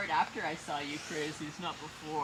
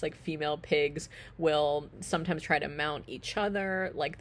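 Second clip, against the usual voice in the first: ordinary talk, nothing marked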